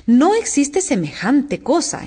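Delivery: high, slow, emphatic objection; contained storybook indignation; indignant. A narrator's reading. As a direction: object firmly, stretching it out. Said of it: "empezaba a decir Alicia con gran indignación".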